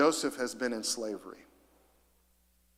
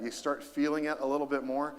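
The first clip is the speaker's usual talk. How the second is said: ordinary delivery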